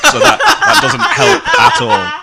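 high, slow, loud laughter through words; laughing. Real, not acted. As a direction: dissolve into laughter. Laughing at Mark's slip.

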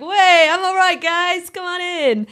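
high, loud and showy Willy Wonka, slowing on come on in; a grand showman welcoming guests; greeting the ticket winners, high and loud as Wonka. Retold or imitated. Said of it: "And he's like".

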